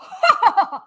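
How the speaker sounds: a high, loud laugh at the haters; scornful cackle; mocking laugh. Real, not acted. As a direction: laugh right in their faces.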